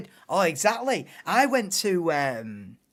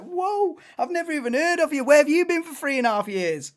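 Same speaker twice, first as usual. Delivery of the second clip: higher, fast, disbelieving whoa; incredulous retort; 7 semitones higher, 4 dB louder than usual. Retold or imitated. Streamer to a stranger in his comments, incredulous and fast. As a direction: scoff back at the commenter in disbelief. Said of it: "I'm like".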